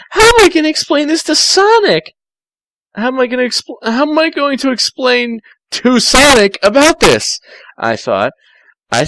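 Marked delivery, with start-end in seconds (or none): 0.1-7.4 high panicked Tails. Acted. Tails fretting about telling Sonic, high panicked voice.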